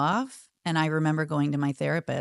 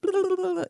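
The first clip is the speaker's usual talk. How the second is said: rapid high blah-blah noise; imitated gush of wordless recital; regurgitating babble. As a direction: spew out a mock babble. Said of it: "you know, regurgitate it".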